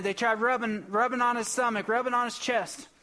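usual speaking voice

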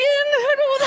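ordinary talk, nothing marked

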